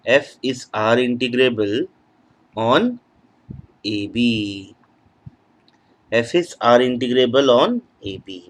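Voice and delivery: teacher dictating word by word, very slowly; slow dictation. Acted. Teacher writing a theorem for students, slow dictation. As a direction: spell it out slowly as you write on the board.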